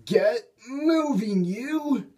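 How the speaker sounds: high, slow pushing cry; urging shout. A narrator's reading. Henry pushes the sulky diesel, high and straining. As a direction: heave him into motion.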